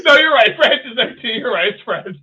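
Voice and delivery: high, loud, cracking up; laughing. Real, not acted. Host teases co-host over UK and Friends, laughing. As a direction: talk through real laughter, easing off at the end.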